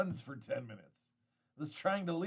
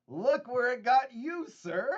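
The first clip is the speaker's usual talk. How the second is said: teasing jab; 8 semitones higher, 7 dB louder, slower than usual. Pretend.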